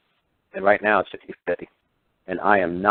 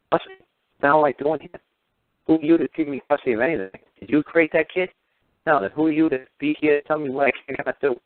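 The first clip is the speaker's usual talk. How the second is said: higher, acted indignant challenge; indignant outburst; 6 semitones higher than usual. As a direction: throw the questions back in outrage. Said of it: "It's like".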